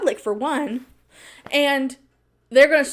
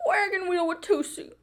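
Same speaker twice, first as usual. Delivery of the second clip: clip quote; 6 semitones higher, 7 dB quieter than usual. Retold or imitated. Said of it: "That's so funny to me".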